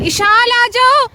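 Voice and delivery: long high call across the park. Real, not acted. Calling daughter back across park.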